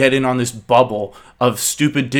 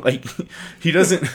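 ordinary delivery

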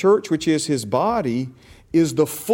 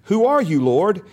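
lilting question; 5 semitones higher, 6 dB louder than usual. Shown as a demonstration. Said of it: "I don't think he said it like that".

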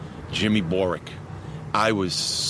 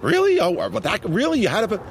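imitating shocked listeners, much higher; incredulous surprise; 10 semitones higher than usual. People hearing the coach's name, shocked and incredulous. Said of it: "people say".

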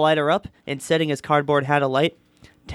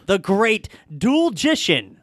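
slow, higher, drawn-out stage introduction; crazed showman; 5 semitones higher, much slower than usual. A narrator's reading. Crazed voice from behind the curtains, showman announcing. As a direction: stretch out the magician's grand title. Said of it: "shouted a crazed voice".

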